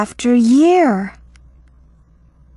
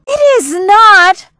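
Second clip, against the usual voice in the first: high, loud protest; a sharp, high cry of denial; cried out; 11 semitones higher, 6 dB louder than usual. A narrator's reading. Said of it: "I cried".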